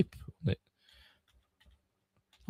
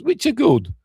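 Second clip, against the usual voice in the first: high, louder squeak of approval; high-pitched concession; 14 semitones higher, 5 dB louder than usual. Real, not acted. Concession said high, then repeated low.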